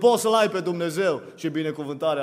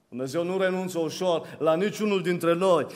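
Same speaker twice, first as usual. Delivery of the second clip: ordinary talk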